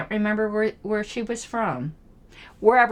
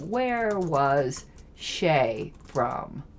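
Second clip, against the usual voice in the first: drawn-out, low and soft; slow, absent-minded; 7 semitones lower, 6 dB quieter, much slower than usual. Real, not acted. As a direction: stretch each word as if typing it out. Slowly repeats question while searching.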